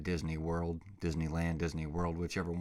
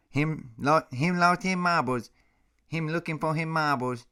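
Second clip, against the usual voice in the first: put-on much higher, louder squeaky baby talk; doggy baby talk; 10 semitones higher, 7 dB louder than usual. Owner cracking himself up, in high loud baby talk. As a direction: squeal it at the puppy. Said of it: "I came up with a new one".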